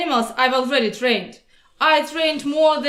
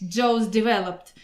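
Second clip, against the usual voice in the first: usual speaking voice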